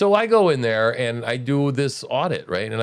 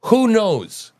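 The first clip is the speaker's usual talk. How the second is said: raised, louder who knows; louder emphatic repeat; 8 semitones higher, 6 dB louder than usual. Real, not acted. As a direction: push the repeat harder.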